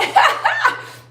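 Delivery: high-pitched burst of laughter. Real, not acted. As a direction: crack up at your own joke. Laughs at own joke.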